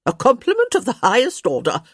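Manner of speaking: quick, high, loud retort; tossed back. A narrator's reading. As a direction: fire back a grand reply. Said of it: "Lady D tossed back".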